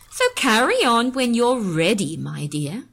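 slow mocking copy of her husband; mimicking. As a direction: parrot his my dear with a sneer. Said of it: "she mimicked".

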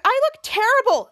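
high, loud acted wail; dismayed wail. Piper to Henry about her ruined hair, wailing. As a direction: lament it like a dramatic girl.

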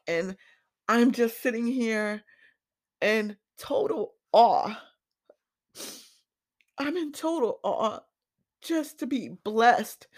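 fighting tears as the words come out; choked up. Real, not acted. Podcaster tells listeners about finding her legacy, voice choking up. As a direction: voice rising high and tight with held-back tears. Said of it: "I may get choked up a bit".

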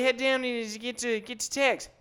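loud, slurred mock-Australian holler; hammed-up drunken Aussie accent; drunk Australian impression. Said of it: "I was, like, drunk in Australia".

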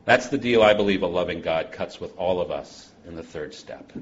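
normal talking voice

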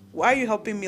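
usual speaking voice

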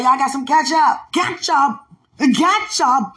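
loud, high mock demand for ketchup; hammed-up asking. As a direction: call out the request, comically overdone. Acting out own request for ketchup.

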